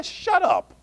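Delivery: dismissive retort. Acted. Elisha brushing off the prophets.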